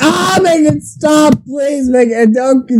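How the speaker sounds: high, slow mock cry; hammed-up pain. Friend hamming up a patient's pain, high mock cries.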